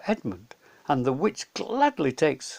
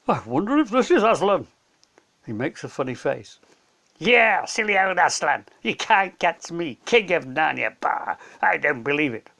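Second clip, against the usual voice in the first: a lightly voiced cheeky boy, jeering; mocking boy; 2 semitones higher, 4 dB louder than usual. Edmund jeers at a stone lion, lightly mocking. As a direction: taunt the statue like a scornful kid.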